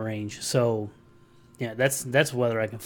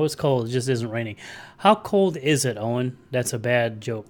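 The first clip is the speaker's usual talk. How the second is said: normal talking voice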